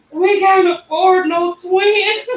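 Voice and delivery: high, loud, slow mock wail; mother in shock at twins, mock wailing; panicked wail. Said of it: "I'm like".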